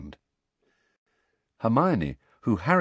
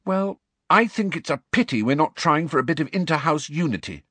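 crossly; 9 semitones higher, 3 dB louder than usual. A narrator's reading. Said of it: "said Hermione crossly".

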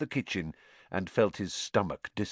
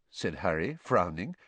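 ordinary delivery, nothing marked

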